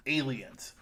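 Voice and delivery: ordinary delivery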